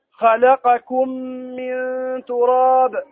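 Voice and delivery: slow, drawn-out chanted recitation; measured tajweed chant; Quran recitation. Shown as a demonstration. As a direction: recite the verse, stretching من تراب. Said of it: "recites verse examples".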